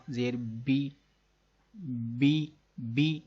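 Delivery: slow drawn-out بی with the voice dipping down; teaching the zer "bi" sound slowly; zer sound demo. Shown as a demonstration. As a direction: stretch بی and let the voice fall. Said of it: "دیکھیے آواز نیچے کو جا رہی ہے".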